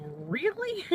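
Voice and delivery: 높게 튀어 오르는 믿기지 않는다는 되물음; 살짝 비꼬듯 놀란 척; incredulous really. Retold or imitated. Skeptical reply to passenger.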